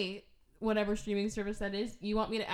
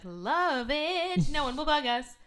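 eager; 6 semitones higher, 6 dB louder, slower than usual. Retold or imitated. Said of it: "he's like".

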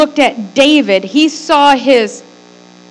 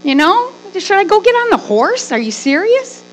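shocked; 6 semitones higher than usual. Retold or imitated. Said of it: "I know it shocked me. I'm like".

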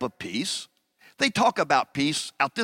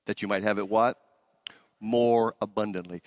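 usual speaking voice

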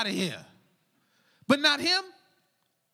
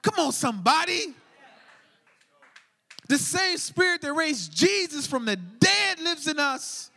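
full-force preaching; 4 semitones higher than usual. Acted. Pastor rallying the congregation, preaching at full force. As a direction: whip the church up to their purpose.

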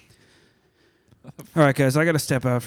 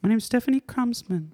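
much higher put-on character voice; shy masked oddball woman; 10 semitones higher than usual. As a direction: introduce yourself as an awkward, shy guest. Plays Stephanie, a shy guest.

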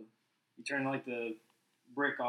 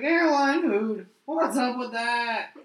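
slow, higher, mock stand-up comic drawl; hammed-up Seinfeld observational bit; Seinfeld impression; 7 semitones higher, 3 dB louder, much slower than usual. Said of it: "Seinfeld impression".

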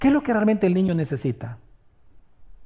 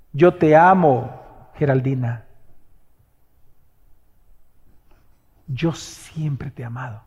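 tender; 5 semitones lower, slower than usual. Acted. God's tender words, soft and slow.

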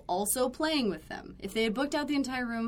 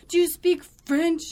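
higher, louder exasperated question; mock the guest's heated challenge; imitated angry demand; 8 semitones higher, 8 dB louder than usual. Irate guest trying another language, mock angry demand. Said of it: "He's like".

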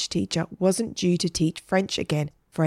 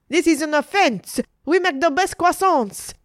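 high, loud offended ranting; outraged waitress; 10 semitones higher, 4 dB louder than usual. A narrator's reading. Waitress defending her pastries to Margaret, outraged shouting. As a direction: proclaim it in high-pitched indignation.

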